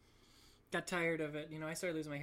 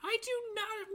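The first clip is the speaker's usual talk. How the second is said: very high squeaky denial; indignant protest; 4 dB louder than usual. Real, not acted. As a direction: deny it in a comic falsetto. Protests Mister Clean worship leader jibe.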